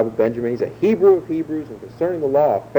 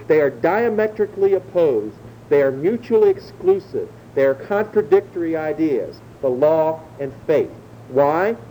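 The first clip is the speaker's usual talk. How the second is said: ordinary delivery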